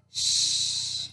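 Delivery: "sh" as a breathy hiss with no voice; digraph sound. Shown as a demonstration. Teacher modelling "sh" for kids, hissed. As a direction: hush out "sh" like quieting a room. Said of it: "S H".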